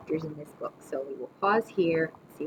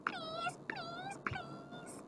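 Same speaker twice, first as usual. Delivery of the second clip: tiny, very high soft whimper; whining like a dog; 19 dB quieter, much slower than usual. A narrator's reading. Boy begging grandma for candy, whining like a dog. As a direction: plead in a thin puppy whine. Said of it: "begin to whine like a dog".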